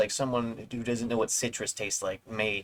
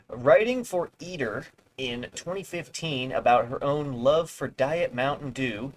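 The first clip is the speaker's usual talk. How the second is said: ordinary talk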